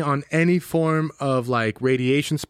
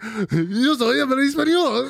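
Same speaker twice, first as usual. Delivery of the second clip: higher, slowed, amazed "Yo soy habla español"; astonished Spanish; 8 semitones higher, slower than usual. Retold or imitated. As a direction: sound stunned at yourself. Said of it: "And he's like".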